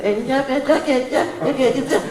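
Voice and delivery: put-on high childish whiny voice; baby talk. Preacher mocking grown believers, high childish baby talk. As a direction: mimic a spoiled grown-up talking like a toddler. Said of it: "hablan como chiquitos".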